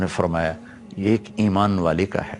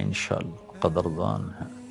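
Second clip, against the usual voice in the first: much softer, falling away; hushed repeat; 3 semitones lower, 10 dB quieter than usual. Real, not acted. Preacher assuring that Allah rewards service, hushed repeat. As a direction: drop to a hushed, private assurance.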